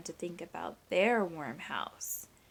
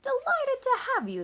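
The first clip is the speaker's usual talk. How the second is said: much higher, louder rooster voice; cheery rooster welcome; 7 dB louder than usual. A narrator's reading. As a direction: crow it out gladly, high and bright. Rooster answers the fish.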